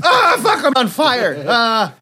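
high, loud hammed-up scream; comic panic. Cannonball finds he is on fire, mock panicked yell. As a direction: yelp through laughter in mock alarm.

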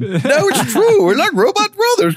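very high squealing excitement; hammed-up falsetto gushing; ecstatic reunion. Acted reunion, robot brothers.

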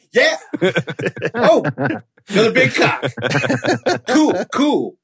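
high, cheerful confident guy voice; mock Tom Cruise. Imitating a breezy confident greeter. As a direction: cheerfully greet every new naked guy. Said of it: "He's just like".

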